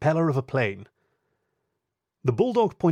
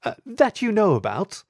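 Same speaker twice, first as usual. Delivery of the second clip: high, smooth, amused upper-class lady; smooth aristocratic lady; 9 semitones higher, 4 dB louder than usual. Acted. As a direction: cut in with cool amusement. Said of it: "the husky interjected".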